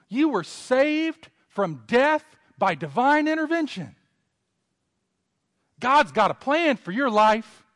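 high, loud hyped-up encourager; pep-talking Moses. Pretend. Preacher playing a flatterer hyping up Moses. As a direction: pump him up like a cheerleader. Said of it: "I said".

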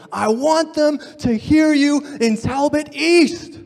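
loud, high, slow scream. Retold or imitated. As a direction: roar it to be heard across campus. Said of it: "he would scream".

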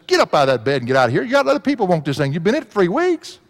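rapid retold scolding, climbing high; mock scolding. Preacher retelling how he chided a hospital patient, mock scolding. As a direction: order the patient out, rushing and rising in pitch.

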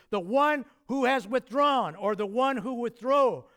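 the one who has withdrawn lifted high and loud; pointing out Greek word order emphasis. Shown as a demonstration. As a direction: lean hard on the one who. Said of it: "there for emphasis".

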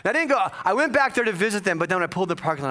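ordinary delivery

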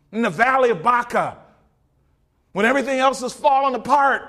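loud, ringing pulpit cry; booming revival preaching; full-force preaching. Acted. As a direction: proclaim it to the back pews.

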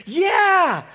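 high, loud, eager whoop; impulsive. Retold or imitated. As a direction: blurt it out at full tilt, stretching the word.

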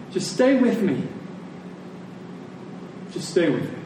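ordinary talk, nothing marked

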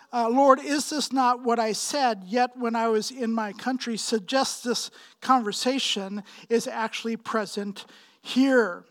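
ordinary talk, nothing marked

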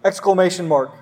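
ordinary talk, nothing marked

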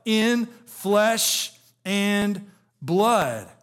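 very slow, word by word; drawn out. Acted. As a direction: stretch out each word of flesh and blood. Preacher drawing out scripture phrase.

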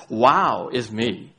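slow, self-pleased wow is me; mock the proud believer; lightly mocking boast; smug wow. Shown as a demonstration. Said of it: "Instead of sometimes our approach to God is".

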